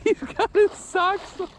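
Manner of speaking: high, wheezing through laughter; gasping laughter mid-sentence; laughing. Real, not acted. Laughing at friend in water.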